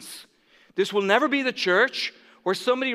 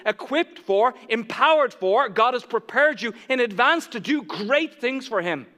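preaching louder and higher, building to a climax; rising preaching; 4 semitones higher, 4 dB louder than usual. Acted. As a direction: build the appeal until it rings out.

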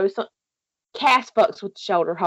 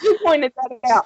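usual speaking voice

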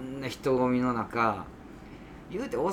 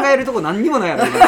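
laughing; 10 semitones higher, 14 dB louder, faster than usual. Real, not acted. Laughing punchline about moving.

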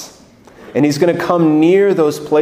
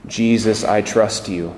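guided prayer; 3 semitones lower than usual. Acted. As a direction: lead the room gently into prayer.

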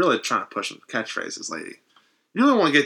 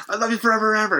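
raised, lightly eerie retelling; milder creepy vow; 8 semitones higher, much faster than usual. Retold or imitated. Retelling the dying wife's vow, mildly high and eerie. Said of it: "Very, uh, very unsettling".

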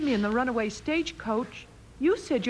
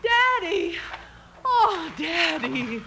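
relieved cry; 5 semitones higher, much slower than usual. Acted. Meg calling to her freed father, relieved cry. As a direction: cry out with relief at seeing him.

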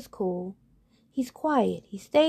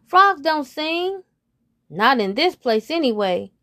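mocking friend; 6 semitones higher, 6 dB louder than usual. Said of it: "says one friend".

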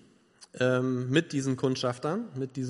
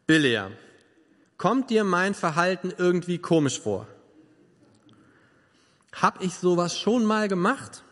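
each word crisp and eloquent, slightly raised; precise, articulate talking donkey; over enunciating; 3 semitones higher than usual. A narrator's reading. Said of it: "er spricht sehr deutlich und sehr eloquent".

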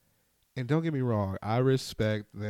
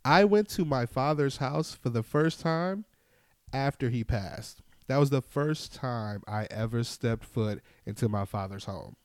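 normal talking voice

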